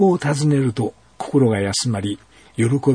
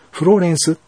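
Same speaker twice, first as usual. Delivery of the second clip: a voice calling her by name, louder and higher; 6 semitones higher, 4 dB louder, faster than usual. A narrator's reading. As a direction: call to her from afar. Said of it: "呼びかける声がしました". (高めに強く呼びかける声)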